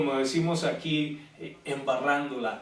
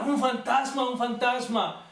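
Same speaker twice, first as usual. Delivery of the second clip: retold frightened shout; 7 semitones higher than usual. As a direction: shout it as terrified disciples would. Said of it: "todos empiezan a gritar".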